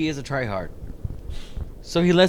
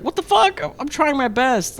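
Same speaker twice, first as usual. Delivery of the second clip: high, exasperated squeal; exasperated self-imitation; 12 semitones higher than usual. Imitating herself losing at Fortnite, high and exasperated. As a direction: pitch it up in mock frustration. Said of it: "I'm just like".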